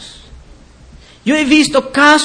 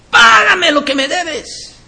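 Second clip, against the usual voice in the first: much higher, mock demand for payment; mimicked creditor's demand; 7 semitones higher than usual. Preacher mimics a brother who won't forgive, high mock demand. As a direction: mimic an unforgiving brother, pitched way up. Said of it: "eso es lo que tú estás diciendo".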